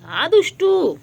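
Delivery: high, loud swooping tease; mock-scold the naughty pet; playful scolding. Real, not acted. (আদুরে বকুনি)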